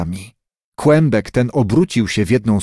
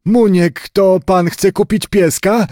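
lightly voiced grandfather, higher; slow, drawn-out start, then a higher question, as a hint of Grandpa; old grandfather; 6 semitones higher, slower than usual. A narrator's reading. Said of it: "spytał dziadek chłopca".

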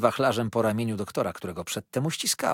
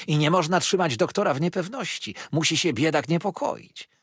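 put-on gushing old mother-in-law, pitched higher; sugary, insistent flattery of the doctor; Mrs Markleham; 7 semitones higher, 4 dB louder than usual. A narrator's reading. Mrs Markleham quotes herself. (przesłodzona, natarczywa teściowa)

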